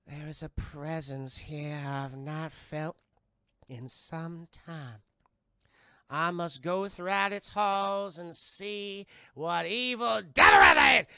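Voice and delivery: slow Southern seer building to a shout; Zelda's Southern accent. Retold or imitated. Seer vowing to search the haunted house, Southern drawl rising to a shout. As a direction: drawl it slowly, then erupt in Southern fervor. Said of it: "a very thick Southern accent".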